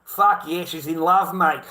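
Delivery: normal talking voice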